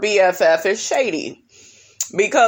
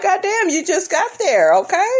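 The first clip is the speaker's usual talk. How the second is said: high mock exclamation; 10 semitones higher than usual. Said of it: "I'm like".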